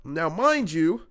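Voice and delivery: ordinary talk, nothing marked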